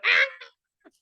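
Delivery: high imitated yelp of agony; pained scream. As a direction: yelp like someone caught in a riot. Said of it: "like, they just got beat up".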